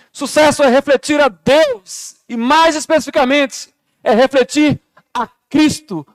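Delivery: loud, high impassioned preaching. Acted. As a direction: preach it with full force. Preaching at full force.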